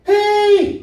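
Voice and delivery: long, very high warning holler; shrill-pitched mock road yell; high-pitched "hey" yell. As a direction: holler it high at another driver. Driver yelling at car drifting over.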